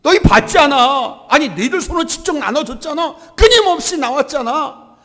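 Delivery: loud, high, insistent reproach; exasperated scolding. Acted. Jesus berating disciples worried about bread, exasperated scolding. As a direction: hammer each reminder at them in disbelief.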